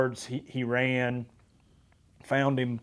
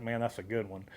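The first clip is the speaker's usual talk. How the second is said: much softer, a hushed aside to himself; hushed thought; 4 semitones lower, 12 dB quieter than usual. Retold or imitated. Hunter in the blind reacting to the warthog, hushed. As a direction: keep it down so the warthog won't hear.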